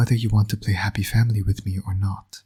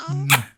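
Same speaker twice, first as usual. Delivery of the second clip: a short, higher smacking kiss; one more quick smooch; kiss for the baby; 5 semitones higher than usual. Acted. Villain dad giving his baby one last kiss, quick higher smooch.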